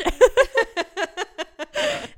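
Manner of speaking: high laughing burst; embarrassed laugh. Real, not acted. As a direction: laugh at your own mistake. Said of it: "my good old Aussie accent".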